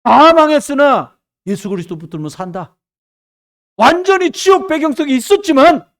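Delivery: loud, emphatic proclamation; full-force preaching. Real, not acted. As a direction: hammer it home to the congregation.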